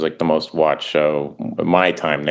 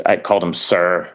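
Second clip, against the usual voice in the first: ordinary delivery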